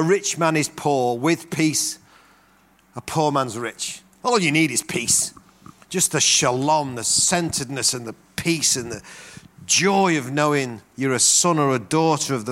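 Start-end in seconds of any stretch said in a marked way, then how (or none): none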